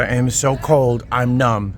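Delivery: usual speaking voice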